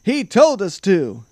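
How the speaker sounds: loud, high, drawn-out blame; crying out. A narrator's reading. Knights point at king to excuse themselves, loud high cry. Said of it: "they cried".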